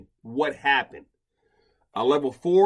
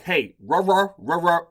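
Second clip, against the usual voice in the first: mock growl; 5 semitones higher, 6 dB louder than usual. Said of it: "Reach out and like".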